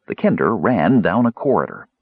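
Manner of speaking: usual speaking voice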